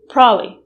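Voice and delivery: probably in just two syllables, prob-ly; reduced probably. Shown as a demonstration. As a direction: drop the B in probably. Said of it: "with just two syllables".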